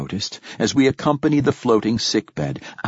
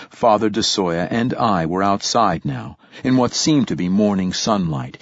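ordinary delivery, nothing marked

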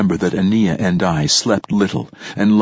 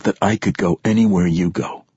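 plain reading voice

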